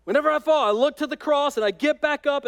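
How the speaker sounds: high, loud, victorious Paul; triumphant declaration. Retold or imitated. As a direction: proclaim rising again with joy. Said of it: "Paul says".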